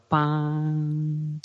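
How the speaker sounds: long, high held "paaan"; drawn-out crash imitation. Shown as a demonstration. Sounding out how the piece ends, drawn-out imitated crash. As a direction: imitate a ringing final hit.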